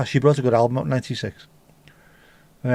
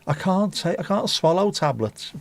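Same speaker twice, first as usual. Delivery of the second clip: higher, slower pleading patient voice; whiny patient; 7 semitones higher than usual. Pretend. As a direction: whine like a helpless patient.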